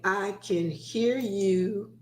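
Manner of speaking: slow, spelled-out prompt; slow and quiet. Shown as a demonstration. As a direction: model the reply slowly. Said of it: "If you can hear me, say".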